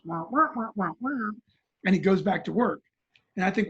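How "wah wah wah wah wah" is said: muffled trumpet wah-wah noise. Retold or imitated. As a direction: voice it like a muted trumpet. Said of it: "this trumpet-like noise that says".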